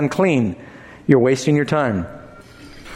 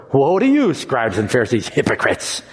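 hard, falling denunciation; condemning; 4 semitones lower than usual. Shown as a demonstration. Jesus denouncing the Pharisees, stern. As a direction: pronounce judgment on them.

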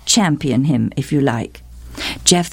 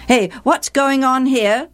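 higher, challenging father's voice; intervening father; 9 semitones higher than usual. A narrator's reading. Father coming in on the quarrel, demanding to know. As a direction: break up a squabble with a raised-pitch question.

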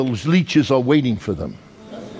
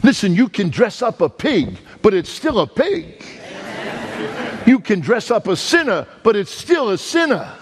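higher, rising preacher's cadence; preaching; 8 semitones higher than usual. Acted. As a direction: hammer the proverb home to the congregation. Preacher drives home a proverb.

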